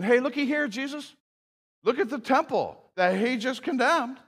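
high, eager show-off voice; mock eager disciple. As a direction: point it out like a proud tourist. Mocks disciples showing off the temple.

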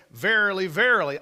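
"Verily, verily" both words punched loud; doubled emphasis. Shown as a demonstration. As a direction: hammer each "verily". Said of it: "so the emphasis was verily".

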